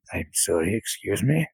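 a deep fiend feigning courtesy; demon voice. Acted. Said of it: "Zul Grubwell goes".

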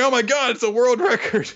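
high-pitched crowd astonishment; amazed onlooker. Acted. Retold crowd amazed at record.

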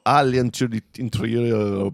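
slow, careful French accent; French accent, drawn out; attempted French pronunciation. Real, not acted. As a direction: take a deliberate run at the French title. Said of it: "Excellent, that was beautiful".

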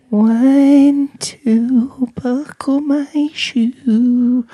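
high, slow eerie singsong; creepy singsong. Acted. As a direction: chant it like a spooky child. Creepy patient in hospital.

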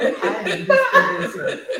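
high, breathless laughing; can barely get it out laughing; laughing through words. Real, not acted. Joking exit amid laughter.

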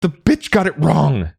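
high, loud, bitter shout. Acted. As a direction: rage at the goddess at the top of your voice. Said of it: "Ingolfur shouted".

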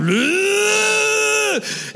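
high, strangled animal noise; hammed-up bear gurgle. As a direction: mimic a wounded bear spitting blood. Bear choking on its blood.

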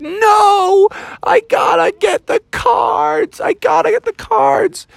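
very high, drawn-out frantic wail; woeful screaming. Acted. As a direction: wail desperately like a locked-out kid. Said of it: "screaming woefully".

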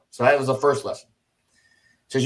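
ordinary talk, nothing marked

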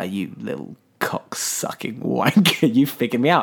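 menacing villain. Retold or imitated. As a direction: play a quietly threatening killer. Villain confronts hero in retelling.

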